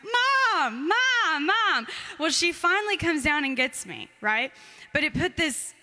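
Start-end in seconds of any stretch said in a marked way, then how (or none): 0.0-1.9 put-on high calls of a child, a little louder; retold child crying out for her mother; high childlike calls of Mom; calling for mom. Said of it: "just crying out".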